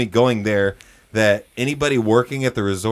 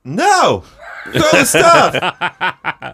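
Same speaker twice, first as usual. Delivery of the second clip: high, drawn-out holler down; mock stubborn yell from a balcony; loud, comic counter-demand; shouting back; 8 semitones higher, 7 dB louder, much slower than usual.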